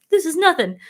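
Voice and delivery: high, loud mock dismissal; scoffing boast. Quoting their group to heat complainers, loud scoffing. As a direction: toss it off loudly, pitched well up.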